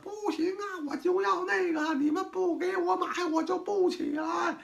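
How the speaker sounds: put-on high, drawn-out whiny kid voice; child on the floor begging parents for a toy, whining; spoiled child whining. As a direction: wail it like a spoiled kid throwing a tantrum on the floor. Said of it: "那孩子跪地下就呱往地下一趴打滚儿".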